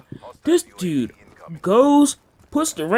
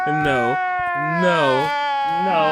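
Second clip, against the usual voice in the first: pleading protest; much slower than usual. Retold or imitated. Protesting the punishment.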